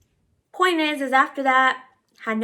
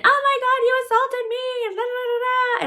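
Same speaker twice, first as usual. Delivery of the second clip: very high-pitched mocking screech; mock screaming; 14 semitones higher than usual. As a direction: imitate a hysterical accuser, way up high. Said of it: "screaming her fucking head off".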